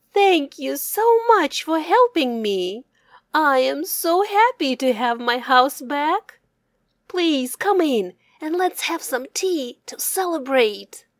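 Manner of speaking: high, loud, joyful thanks; happy hare. Acted. Hare thanking the old man for his house back, high and joyful. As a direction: bubble over with gratitude.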